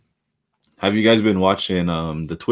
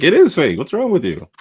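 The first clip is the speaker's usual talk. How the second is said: high, louder mock outrage; mock indignant; 10 semitones higher, 4 dB louder than usual. [name] doubling down on wrestlers, high indignant mock shout. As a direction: bark it back, high and indignant. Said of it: "[name] doubled down, like".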